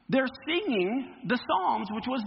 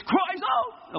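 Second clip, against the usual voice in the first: shouted cries out; 9 semitones higher than usual. Shown as a demonstration. Preacher showing the class how to say it, shouting.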